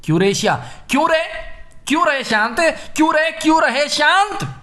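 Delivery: mock heckler, pitch jumping up on the questions; defiant troublemaker. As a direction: lightly play an unruly protester challenging an order. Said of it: "शरारती तत्व होते हैं".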